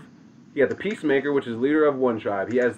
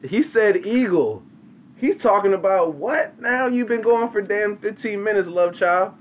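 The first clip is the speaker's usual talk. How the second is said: high, exasperated mock heckler, slowed at the start; mock impatient listener; 8 semitones higher than usual. Storyteller mocking restless listeners in a high, exasperated voice. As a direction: whine like a bored listener. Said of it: "everyone else that's like".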